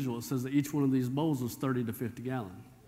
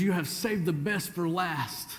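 slow, higher, proclaiming delivery; preaching emphasis; 4 semitones higher, slower than usual. Real, not acted. Preacher proclaiming the steward's words to congregation. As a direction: proclaim it as the climax.

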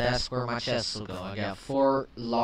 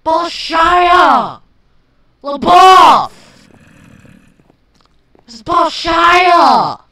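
much higher, slow, furious shouting; raging scream; 14 semitones higher, 17 dB louder, much slower than usual. Real, not acted. Gamer raging at mobs invading his house. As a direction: scream with full gamer rage. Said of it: "leftover rage peeking through".

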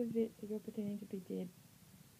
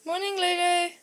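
loud sing-song call; 5 semitones higher, 12 dB louder than usual. Real, not acted. Waking a sleeping puppy.

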